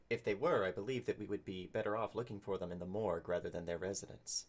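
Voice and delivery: measured, understated formal delivery; reserved adventurer, lightly acted; lightly voiced formal character. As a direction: speak formally, with restraint. Player speaks as formal adventurer character.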